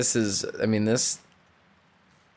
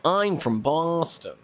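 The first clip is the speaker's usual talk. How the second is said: higher-pitched, hammed-up Boston accent; 5 semitones higher than usual. Shown as a demonstration. Streamer tries out a Boston accent on viewers, hammed-up. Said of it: "I can't do a Boston accent".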